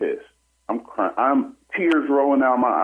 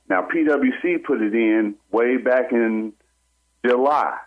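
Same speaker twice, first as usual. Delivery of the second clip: ordinary talk, nothing marked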